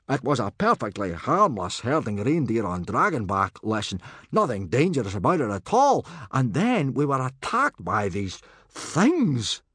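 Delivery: loud, high-swinging, agitated account; flustered stammer. A narrator's reading. Gobber excuses the lesson gone wrong, agitated. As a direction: protest innocence, then draw out the horror. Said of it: "stammered Gobber".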